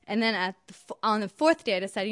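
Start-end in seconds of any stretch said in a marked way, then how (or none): none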